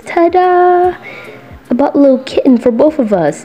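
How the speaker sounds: high, fast cheerful reveal; excited ta-da. Acted. Mikey reveals kitten to listener, high and excited. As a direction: present the gift proudly.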